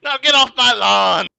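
put-on loud, high old-man yell; grumpy old man. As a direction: shout like a cranky neighbor.

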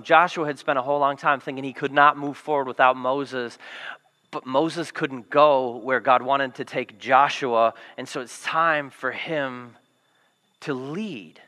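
ordinary talk

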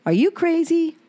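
sharp high cry of disbelief; incredulous outburst. Pretend. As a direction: fling it at him like he's lost his mind. Imagined outburst at ex-husband.

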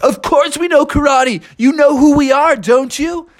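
high, loud dude voice; indignant turtle. Acted. Turtles answer Froggy about karate, indignant. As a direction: protest as if everyone should know.

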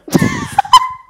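high, loud burst of laughter; squealing laugh; shrieking laugh. Real, not acted. Shrieking laugh at friend's remark.

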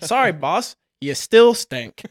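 loud punchline, slowing heavily on the end; drawn-out, emphatic put-down; mock blunt verdict. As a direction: deliver the bad news with comic force. Punchline to the shower analogy.

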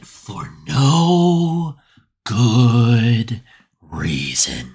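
very slow, low, drawn-out repeat; ominous mock-narrator echo; dramatic echo. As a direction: stretch good reason like a trailer voice. Echoes the line dramatically.

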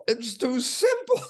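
"too simple" as happy discovery; delighted. Retold or imitated. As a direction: lift "simple" up in gleeful triumph. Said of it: "I thought".

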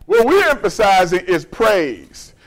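normal talking voice

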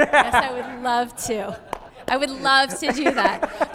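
bright and willing. Shown as a demonstration. Mother answering kids' demands, slow and cheerful. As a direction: say it like you truly mean it. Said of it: "I say it until I believe it".

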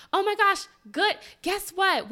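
high, loud, gushing oh my gosh; excited caller. Retold or imitated. Imitating the excited clinic lady calling back. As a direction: burst in with big news. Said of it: "lady calls me".

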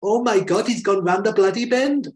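high, loud mock Welsh accent. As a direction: do a panicky Welsh aide. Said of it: "the Welsh aide is like".

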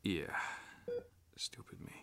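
hushed, self-blaming whisper. Acted. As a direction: breathe it out in shame. Self-reproach in hospital.

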